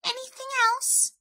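very high little question; high, small storybook mouse; inquiring mouse. A narrator's reading. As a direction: inquire hopefully as a tiny mouse. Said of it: "asked Mouse".

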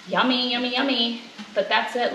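ordinary delivery

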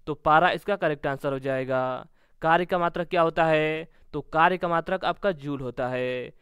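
normal talking voice

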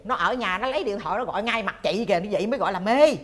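loud, scoffing reassurance; emphatic retold advice. Older friend setting a worried wife straight, loudly emphatic.

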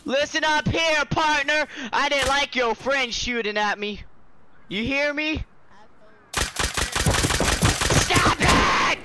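high, loud, slow mock cowboy drawl. Gamer taunts another player, hammed-up cowboy voice. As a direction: play a brash cowboy calling out a partner.

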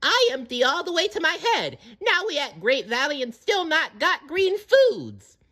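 very high squeaky little creature voice; Petrie. A narrator's reading. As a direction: whine in broken baby talk, pitched sky-high. Petrie complains of hunger.

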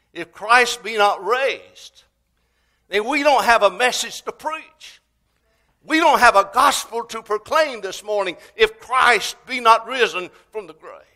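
loud, high impassioned proclamation; full-force preaching. Real, not acted. Preacher on there being no gospel without resurrection, preaching forcefully.

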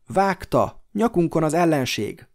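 high, loud warning cry; shout. A narrator's reading. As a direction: shout the danger to everyone. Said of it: "kiáltotta". (sürgető kiáltás)

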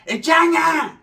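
loud, high call across the house. Retold or imitated. Host calling Changa to come say hi, loud high call. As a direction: call her from the other room. Calling to someone in another room.

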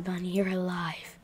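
relieved whisper. Acted. Relief at finding friend.

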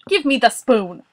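loud, impatient demand; Boris. A narrator's reading. Boris taking the spoon from Morris, shouting. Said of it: "Boris shouted".

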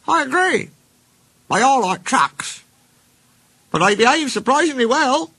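very high, agreeable little-engine voice; small engine Rex. Acted. Rex answering, smiling, as small engine.

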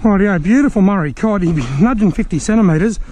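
ordinary delivery

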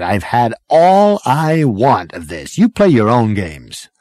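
wash your hands of it; fed-up Zaphod. A narrator's reading. Zaphod quits angrily.

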